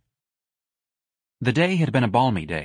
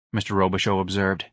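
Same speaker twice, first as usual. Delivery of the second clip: plain reading voice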